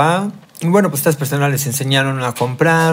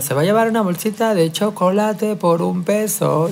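imitating a child hawking chocolates; street vendor's cry; 6 semitones higher than usual. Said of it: "grito, y yo".

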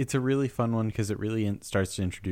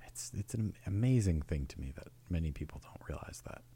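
soft hushed golf announcer; lightly voiced golf commentator; 8 dB quieter than usual. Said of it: "You have, like, a golf commentator voice on now".

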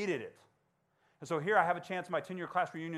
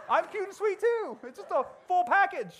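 high, laughing, louder mock boast; laughing mock brag; 7 dB louder than usual. Happy bragging to the girls, laughing high mock boast. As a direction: brag through laughter, pitched way up.

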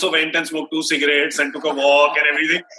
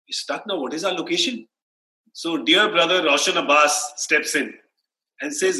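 ordinary talk

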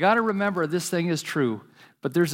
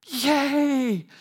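put-on high squeaky child's "Yay!"; little boy shouting yay; excited kid cheer; 10 semitones higher than usual. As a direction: cheer like a thrilled five-year-old.

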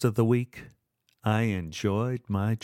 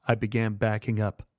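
ordinary delivery

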